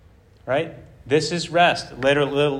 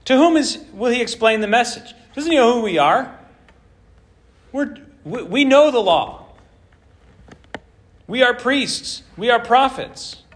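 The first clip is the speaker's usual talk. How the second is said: haughty priests; 7 semitones higher than usual. Acted. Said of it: "some haughty, haughtiness here".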